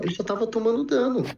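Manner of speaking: ordinary talk, nothing marked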